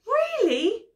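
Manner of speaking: high, loud surprised "really"; a storybook bear, taken aback; astonished bear. A narrator's reading. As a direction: voice leaps up in astonishment. Bear startled by boy's claim.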